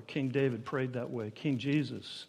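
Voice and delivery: ordinary talk, nothing marked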